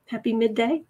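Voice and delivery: usual speaking voice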